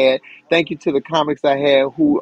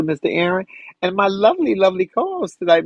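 normal talking voice

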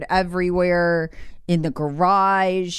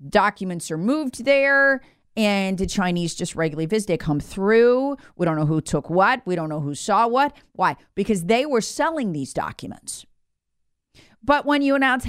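ordinary talk, nothing marked

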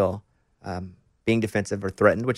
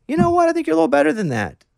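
higher mock voice of the wife; imitating wife; 4 dB louder than usual. Husband retelling what his wife told him, higher mock wife voice. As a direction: pitch up to play his wife's gentle verdict.

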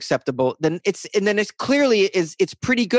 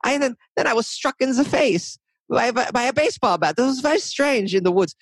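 hammed-up German accent, pitched higher; 8 semitones higher than usual. Shown as a demonstration. Podcaster demoing his quote voices for listeners, hammed-up German accent. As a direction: play a German witness with a mock German accent, voice up high. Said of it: "they might quote somebody with a German accent".

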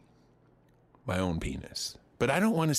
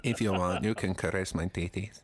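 mock Spanish accent, lower pitch; Penelope Cruz; 4 semitones lower than usual. Imagined star inviting the comic, Spanish accent. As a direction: coax in a put-on Spanish accent. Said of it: "That's my Spanish accent".

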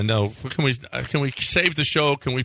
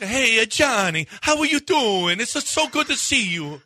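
imitating Italian in-laws, higher; Italian accent; 5 semitones higher than usual. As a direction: greet Johnny like an old-country Italian uncle. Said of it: "my brothers-in-laws, they talk".

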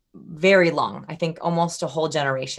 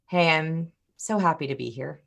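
subdued and indifferent, energy drained out; unenthused greeting. Shown as a demonstration. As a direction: say it with no excitement at all. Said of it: "but they are radically different".

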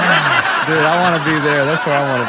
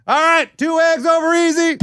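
yelled order; 12 semitones higher than usual. Retold or imitated. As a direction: shout the order to the cook. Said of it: "everyone just yells crap".

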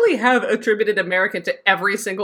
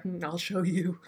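quiet threat; 11 dB quieter than usual. Retold or imitated. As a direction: say it softly, like a private promise of revenge. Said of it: "And I was like".

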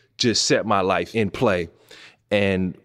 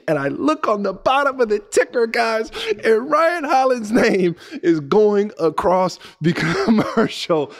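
excited disbelief; 12 semitones higher than usual. Real, not acted. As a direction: relive the shock of seeing your name. Sees his name on ticker.

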